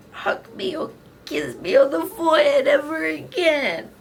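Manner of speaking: put-on a child's wail of despair; crying little girl. Girl fearing Miss Mia's anger, high crying wail.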